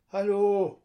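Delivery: loud, high call toward the boat. A narrator's reading. Boy calls out to the fishing boat. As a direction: call out across the harbour. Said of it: "ruft Lukas".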